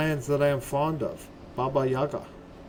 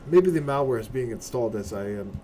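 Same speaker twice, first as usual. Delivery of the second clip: ordinary talk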